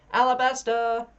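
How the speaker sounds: loud high call of a name. A narrator's reading. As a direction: shout the name into the forest. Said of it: "the fairies call".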